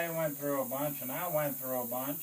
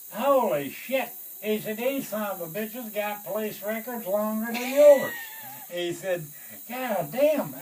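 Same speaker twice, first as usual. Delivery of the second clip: colonel's shocked outburst, higher and louder; 7 semitones higher, 8 dB louder than usual. Retold or imitated. Colonel reacting loudly to the crew's police records. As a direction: blurt it out in disbelief.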